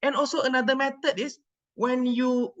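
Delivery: ordinary delivery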